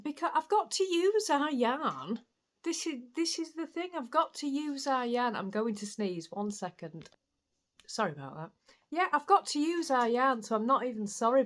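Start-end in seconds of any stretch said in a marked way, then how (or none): 0.0-5.4 defiant. Real, not acted. Defiant excuse, pitched up. 8.9-11.4 defiant. Real, not acted. Defiant excuse again.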